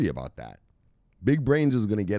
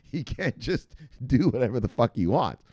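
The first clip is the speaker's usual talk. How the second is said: normal talking voice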